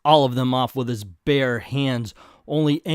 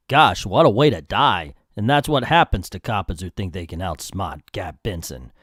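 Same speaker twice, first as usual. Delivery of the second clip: lower-pitched mobster voice; gloating gangster; 6 semitones lower than usual. Acted. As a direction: gloat like a comic-book hoodlum.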